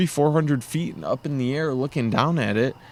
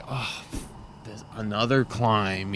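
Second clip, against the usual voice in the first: low, subdued inner grumble; lightly acted sinking-heart sigh of words; mock dejection; 5 semitones lower, 7 dB quieter than usual. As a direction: voice a resigned thought, softly. Voicing weary thoughts at another climb.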